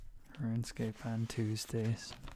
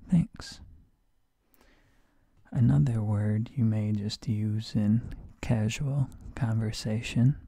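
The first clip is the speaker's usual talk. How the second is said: usual speaking voice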